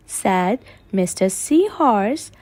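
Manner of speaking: ordinary delivery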